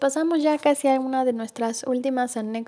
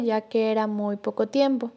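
ordinary talk, nothing marked

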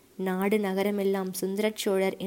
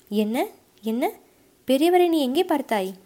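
jolted into a demanding shout; startled outburst; 5 semitones higher, 5 dB louder than usual. A narrator's reading. Commander startled by brother's mention.

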